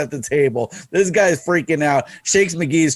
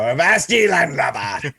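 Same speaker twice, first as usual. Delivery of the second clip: pirate voice; 2 semitones higher than usual. Retold or imitated. Eye-patch man greeting the speaker in a mock pirate voice. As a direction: play it like a stage pirate. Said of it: "walking by one time go".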